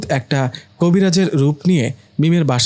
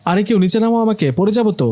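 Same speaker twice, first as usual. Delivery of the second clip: higher, scared plea to be brought down; panicked cry for help; 6 semitones higher than usual. Acted. Fake healer floating mid-air begs the family, panicked. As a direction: yelp it like he is about to fall.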